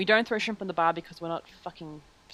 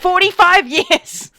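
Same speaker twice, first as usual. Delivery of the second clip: very loud, high, stretched-out yell; mock exasperated shout; 11 semitones higher, 13 dB louder, much slower than usual. Friend topping the joke about waiting, mock exasperated shout. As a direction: top it with a slow mock bellow. Tops own exaggeration of the wait.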